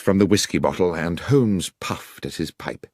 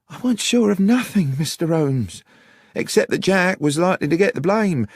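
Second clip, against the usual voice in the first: put-on high-pitched, worried young countrywoman; Miss Miller, worried explanation; 11 semitones higher, 4 dB louder than usual. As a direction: explain your fears in a much higher voice. Voices Miss Miller in story.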